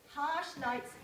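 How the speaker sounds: ordinary delivery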